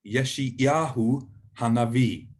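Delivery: Yeshayahu Hanavi said slowly, syllable by syllable; slow for copying. Shown as a demonstration. Teacher says Yeshayahu Hanavi slowly so learners can copy. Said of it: "I'll do it slowly".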